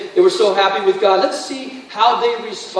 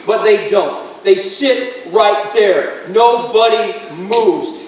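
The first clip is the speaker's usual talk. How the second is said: ordinary talk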